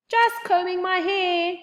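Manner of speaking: squeaky high voice, cracking upward; high-pitched squeak. Acted. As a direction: squeak it out, embarrassed. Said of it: "with a high-pitched squeak".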